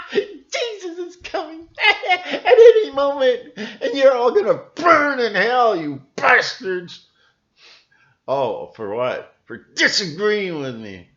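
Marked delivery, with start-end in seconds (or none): 0.1-7.1 high, loud cackling mock hellfire preacher; hammed-up doom preaching with laughter. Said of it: "'cause you're waiting for Jesus".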